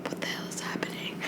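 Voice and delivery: hushed, breathless whisper of disbelief; confused whisper. Retold or imitated. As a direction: whisper it as if you can't believe it. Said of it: "And I was just like".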